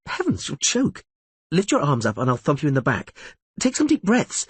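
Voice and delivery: high, urgent, worried; alarmed. A narrator's reading. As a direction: hurry to help someone choking. Geralt choking, Yennefer alarmed.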